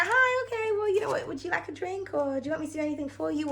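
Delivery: high, overly sweet people-pleaser voice, fast at the end; mock-sweet, eager to please an angry person; sugary sweet reply. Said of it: "you're just like".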